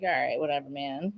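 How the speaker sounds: low, soft shrug; laid-back. Retold or imitated. Recalling her chill stage, low relaxed shrug. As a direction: let it go lazily.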